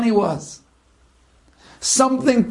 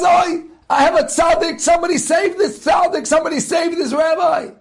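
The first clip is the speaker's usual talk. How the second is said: higher, shouted plea for help; desperate shout; 6 semitones higher than usual. Acted. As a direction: yell for a doctor in panic. Murderer begs hospital for help.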